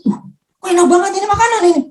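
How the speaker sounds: high, quick burst of amazed delight; amazed, nearly tearful. Retold or imitated. Patient tasting surprisingly good food, amazed and nearly tearful. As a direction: retell delight just short of tears. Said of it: "hampir mau nangis itu, belum nangis".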